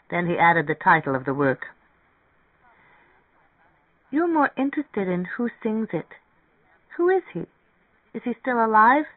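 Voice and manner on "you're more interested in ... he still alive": high-pitched, inquisitive character voice; curious woman character. Acted. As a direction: voice her higher, full of curiosity. Narrator voices curious Mary Ann.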